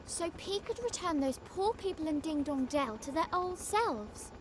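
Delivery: usual speaking voice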